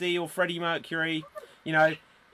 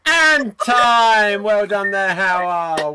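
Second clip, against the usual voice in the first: loud announcement; 4 semitones higher, 10 dB louder, much slower than usual. Real, not acted. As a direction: shout out time's up. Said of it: "host calls time".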